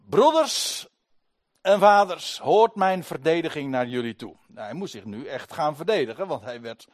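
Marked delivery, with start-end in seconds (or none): 0.1-4.4 hoog, luid redenaarsstem; preken op volle kracht. Acted. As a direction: spreek als een redenaar tot het volk. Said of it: "Nu begint zijn toespraak". (Paulus spreekt de menigte luid toe vanaf de trappen)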